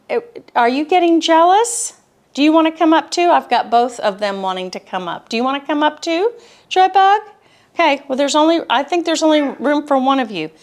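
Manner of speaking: high, bright coaxing voice for the dogs; pet talk. Real, not acted. Speaker coaxing her jealous dogs, high cooing pet talk.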